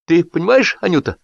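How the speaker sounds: put-on high, emotional drunken speech; a burned-tongue host gushing thanks; tipsy host's toast. A narrator's reading. Drunk host thanking his guests, emotional.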